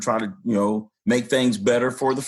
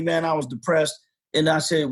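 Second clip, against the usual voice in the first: ordinary delivery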